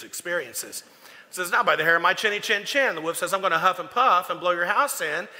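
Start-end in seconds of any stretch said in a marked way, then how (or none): 1.5-2.9 louder, fast, defiant pig squeal; defiant little pig. Acted. Pig taunts the wolf at the door, fast and loud. 3.3-5.3 wolf threat. Acted.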